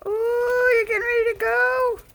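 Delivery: very high cooing sing-song. Real, not acted. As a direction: coo at the bird, way up high. Excited baby talk to bird.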